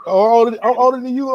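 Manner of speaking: ordinary talk, nothing marked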